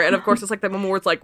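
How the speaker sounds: normal talking voice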